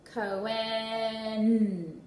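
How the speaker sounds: "Cohen" drawn out with the final N punched; stretched name. Shown as a demonstration. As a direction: stretch "Cohen" slowly and hit the last N. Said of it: "stretching out the whole name and then punch out the last sound".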